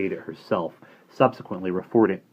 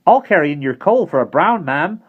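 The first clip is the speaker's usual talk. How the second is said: put-on high, loud and cheeky boy's voice; cheerful boy; 10 semitones higher, 9 dB louder than usual. Simon explaining himself to Bonnie, cheerful and cheeky. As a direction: toss it off with a wink. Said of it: "Simon suggested".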